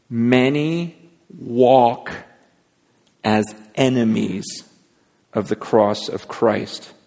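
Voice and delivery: very slow, with weighty pauses; slow emphasis. Real, not acted. Preacher drives the verse home to the congregation, slow and deliberate. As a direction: spell it out phrase by phrase, slowly.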